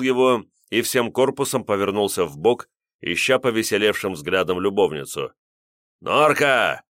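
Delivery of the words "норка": loud, smirking call across the room; smirking shout. Acted. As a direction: shout her name with a grin. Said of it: "с ухмылкой крикнул Ливер".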